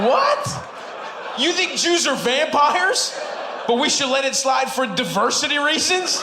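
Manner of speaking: can't believe what grandma just said; laughing in disbelief at grandma's logic; incredulous. Pretend.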